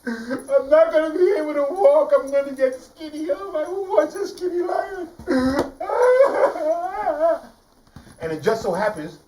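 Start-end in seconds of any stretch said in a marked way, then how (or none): 0.1-5.1 high, loud panicked wailing; hurt lion panicking over a thorn; lion in pain. Acted. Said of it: "And he goes". 5.1-7.5 laugh. Real, not acted. Teller laughing at own lion.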